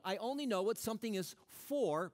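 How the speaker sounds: ordinary talk, nothing marked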